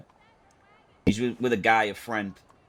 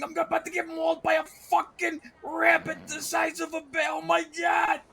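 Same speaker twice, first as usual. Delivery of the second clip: panicked screaming. Retold or imitated. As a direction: scream for the sheriffs in blind panic. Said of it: "I'm screaming at the top of my lungs".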